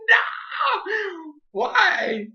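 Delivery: high, crying shout of protest; anguished shout. Retold or imitated. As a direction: scream it at the screen through tears. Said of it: "And I was like".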